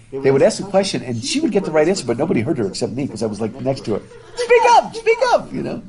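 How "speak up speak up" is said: much higher, louder call to speak up; teasing a shy classmate, high loud call; playful shout. Retold or imitated. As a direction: yell it across the classroom, laughing. Urging quiet friend in class.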